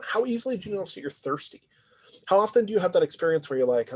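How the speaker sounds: ordinary delivery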